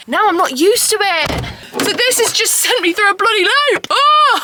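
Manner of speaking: high, loud wail building to a yell; exasperated outburst. Real, not acted. Exasperated complaint about exhaustion.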